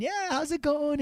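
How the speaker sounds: high, bright-pitched cheery hello; excited greeting. Retold or imitated. Family at the door, cheery high greeting. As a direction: greet him like happy homeowners.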